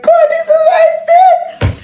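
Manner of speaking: high wailing cry; sobbing shout, voice breaking. Real, not acted. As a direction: let it burst out in tears. Crying out in relief.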